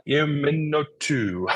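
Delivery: Iminotu stretched out slow and high; tentative name pronunciation. Real, not acted. Greeting a newcomer by username, tentatively drawing out Iminotu. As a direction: sound out Iminotu slowly, high and loose. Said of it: "have to practice pronouncing, uh, your name".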